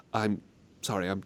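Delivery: usual speaking voice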